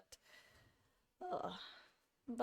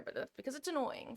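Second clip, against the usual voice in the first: normal talking voice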